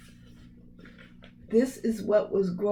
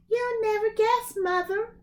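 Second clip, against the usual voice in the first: put-on higher, proud boy's voice; high boyish Jack; 13 semitones higher than usual. Jack teasing his mother about the sale, high boyish voice. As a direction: tease mother with a secret.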